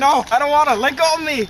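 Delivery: high, loud protest; shouting. Acted. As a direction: yell and pull away. Child resisting parent.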